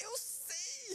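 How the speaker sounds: high-pitched exasperated outburst; mock-exasperated squeal. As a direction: fire back in comic frustration, voice shooting up. Acting out exasperated reply to advice.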